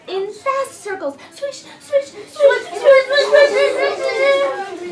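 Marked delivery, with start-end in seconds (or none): 1.3-4.9 high, loud, laughing swish swish; excited swish sounds. A narrator's reading. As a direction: squeal the swishes with glee.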